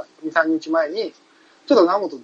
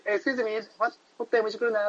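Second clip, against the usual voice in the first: mock tourist asking the time; 6 semitones higher, 8 dB quieter than usual. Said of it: "外人に".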